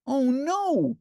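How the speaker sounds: high puppet wail; Ernie wailing. A narrator's reading. Ernie finding Bert missing, high wail. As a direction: cry out in panic as Ernie. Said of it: "cried Ernie".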